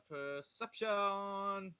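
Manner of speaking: long, slow game-show style call; drawn-out roll announcement. Pretend. Player announces a dice roll.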